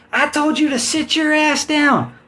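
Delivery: high, slow stern woman officer; a high, slow stern policewoman voice; female cop voice. Retold or imitated. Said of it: "I hear this female voice go".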